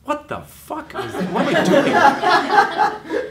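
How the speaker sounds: high, slow, stretched mock horror, laughing; horrified disbelief. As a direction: drag it out in hammed-up dismay. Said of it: "you watch it and you go".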